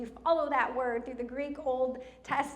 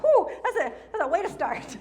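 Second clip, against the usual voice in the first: higher, louder whew breaking into laughter; amused relief; 6 semitones higher, 10 dB louder than usual. Retold or imitated. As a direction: laugh through a recalled reaction. Recalls reacting to intense reading.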